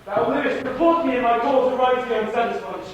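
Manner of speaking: loud, high, desperate command; urgent plea. Acted. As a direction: beg him with all your strength. Dying Hamlet charges Horatio.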